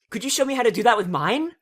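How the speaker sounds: 1 tag only: eager plea. Acted. Pinkie eager request.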